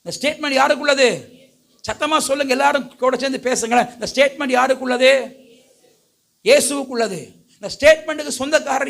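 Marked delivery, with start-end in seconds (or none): none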